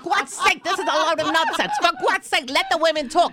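high, squawking mock tirade; Linda. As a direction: scold the host in an exasperated high caricature. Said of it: "I can do a Linda".

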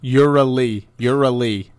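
"Urally" broken off as a drill, repeated firmly; coaching the ending. Shown as a demonstration. Co-host coaching a stuck partner, firmly drilled "Urally". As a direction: model only the tail of "particularly", "Urally". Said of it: "You could do it".

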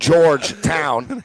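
Georgetown drawn out as two slow words; very slow Georgetown, broken in the middle; Mark Lawrence. Retold or imitated. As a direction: split Georgetown into George, pause, town. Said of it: "separates everything in two".

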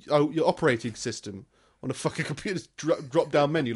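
ordinary talk

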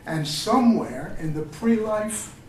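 slow, measured poetry recital; solemn tribute poem read aloud. Acted. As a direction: let each image land slowly. Reads his poem to Vallejo.

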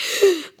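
a quick, high, shocked intake of breath; sharp gasp. Retold or imitated. Microsoft PR guy reacts backstage with a sharp gasp. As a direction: gasp like a stunned PR man. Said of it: "the P R guy from Microsoft was like".

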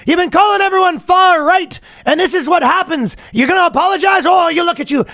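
very high mock Irish accent; indignant fake Irish heckler, pitched high; imitated angry Irishman. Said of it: "the Irish people are kinda not having him".

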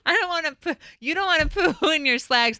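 giggling through the words; laughing. Real, not acted. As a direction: repeat it while cracking up. Laughing while repeating cohost's line.

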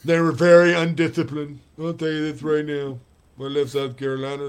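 lisping coach voice; light Lou Holtz lisp; Lou Holtz impression. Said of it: "You gotta go more Daffy Duck".

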